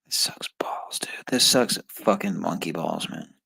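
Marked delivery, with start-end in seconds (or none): 0.0-1.1 hushed, exasperated grumble; a quiet groan of frustration whispered to himself; frustrated whisper. Real, not acted. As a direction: whisper it under your breath, fed up. Frustrated muttering over a test.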